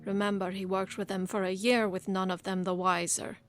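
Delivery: loud, mocking taunt; taunting assassin.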